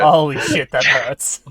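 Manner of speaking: loud pained exclamation; loud outburst. Real, not acted. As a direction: blurt it out in real pain.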